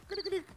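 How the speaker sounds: high mock cricket chirp with a laugh. MC mocking the silence with cricket chirps, high. Imitating crickets, no parents come.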